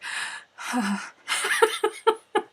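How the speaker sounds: high laughing sigh of relief; a long high laughing sigh of relief; relieved laughing sigh. Retold or imitated. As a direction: let out the tension. Relief at uncle's approval.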